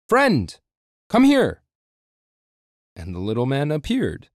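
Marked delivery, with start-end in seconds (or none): 0.1-1.6 much higher, louder call. Acted. Overseer calls loudly for the little man. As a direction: call across the kitchen. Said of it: "He finally cried out".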